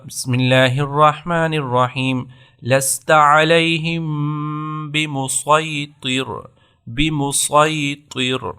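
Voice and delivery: بمسیطر recited slowly with a heavy ص; thick saad recitation. Shown as a demonstration. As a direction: stretch out بمسیطر with full saad. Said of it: "صرف سواد ہی پڑھنا ہے". (بمسیطر موٹے صاد کے ساتھ آہستہ تلاوت)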